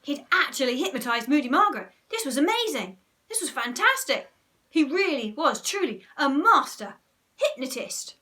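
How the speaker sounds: loud, high, excited narration; gleeful, swelling wonder; thrilled amazement. A narrator's reading. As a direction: marvel at an astonishing success.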